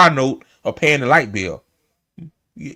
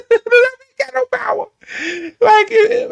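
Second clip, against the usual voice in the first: laughing. Real, not acted. Repeats it, cracking up.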